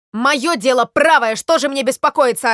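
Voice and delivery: loud, emphatic self-righteous shout; heated acted bluster; indignant yelling. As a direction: insist loudly that you are wronged. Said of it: "орал Воробей Воробеич".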